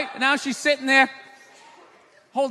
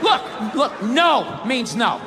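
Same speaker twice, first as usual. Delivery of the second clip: ordinary talk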